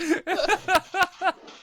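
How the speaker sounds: high, loud trailing laugh; can't stop laughing; helpless giggle; high laughter. Real, not acted. Laughing at the cheese theft story.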